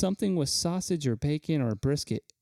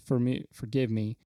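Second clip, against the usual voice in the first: normal talking voice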